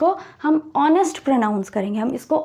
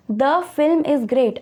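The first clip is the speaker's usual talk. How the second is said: slow example read; much slower than usual. Shown as a demonstration. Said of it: "teacher reads example sentence".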